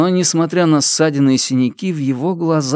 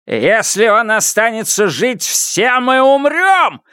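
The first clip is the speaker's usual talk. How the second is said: high cry, slowing and rising at the end; rally the crowd with an alarmed shout; 10 semitones higher than usual. A narrator's reading. Лю Тяньшан turning the crowd against the victim, shouting. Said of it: "закричал Лю Тяньшан".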